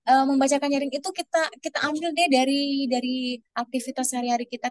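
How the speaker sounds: usual speaking voice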